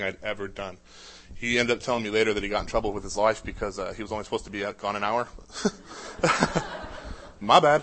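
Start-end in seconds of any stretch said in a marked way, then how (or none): 5.3-7.4 breathy, high laugh at himself; laughing at the memory; breathy laugh trailing down; real laugh. Real, not acted. Laughs at his own story.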